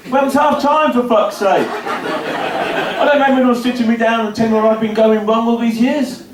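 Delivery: normal talking voice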